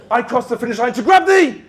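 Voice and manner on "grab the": triumphant shout. Real, not acted. Crossing the finish line, winning.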